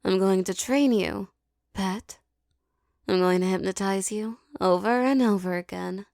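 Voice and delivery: ordinary talk, nothing marked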